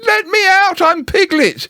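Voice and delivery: very high, pleading squeaky cry; protesting Piglet. Acted. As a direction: beg to be let out.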